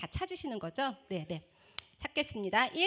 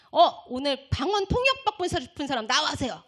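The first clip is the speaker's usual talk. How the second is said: raised, louder pastor's call; visiting pastor; 4 semitones higher, 7 dB louder than usual. Retold or imitated. Pastor summons the prayer crowd forward, calling out. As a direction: call people forward like a preacher. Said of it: "강사 목사님이".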